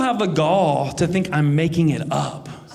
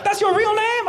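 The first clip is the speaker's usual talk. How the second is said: voice even higher. Retold or imitated. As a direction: push the pitch higher still, thrilled. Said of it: "His voice went up even higher".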